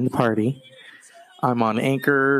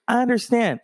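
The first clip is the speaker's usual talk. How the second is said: usual speaking voice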